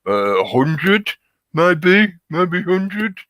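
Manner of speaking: high, slow, clueless mock answer; dim-witted voice. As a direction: play dumb, unsure and dopey. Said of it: "And they're like".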